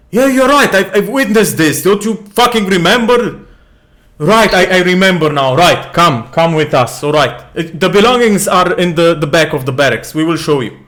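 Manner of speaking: loud, high guard protesting; arguing guard. Acted.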